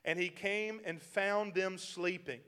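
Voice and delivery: normal talking voice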